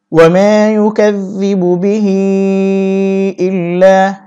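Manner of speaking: slow melodic tilawat, each vowel drawn out; Quran recitation. Shown as a demonstration. Teacher reciting the verse to students in slow tilawat. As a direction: chant it like a reciter, slow and sung. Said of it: "teacher recites the verse".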